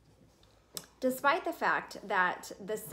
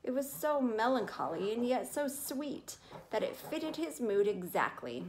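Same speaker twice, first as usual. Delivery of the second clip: usual speaking voice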